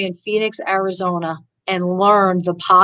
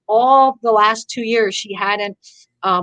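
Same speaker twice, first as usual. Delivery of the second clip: usual speaking voice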